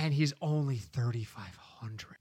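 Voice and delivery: whispering; quiet conspiratorial whisper; hushed suspense. Pretend. Whispered aside about cheap player.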